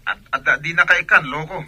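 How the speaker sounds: angry shout. Acted. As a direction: explode at him, really loud. Friend angrily refused money.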